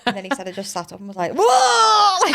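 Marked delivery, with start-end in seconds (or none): 1.4-2.3 sudden high loud whoa; startled whoa. Retold or imitated. Woman waking in labour cries out to husband, high and loud. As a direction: jolt awake with a loud high cry.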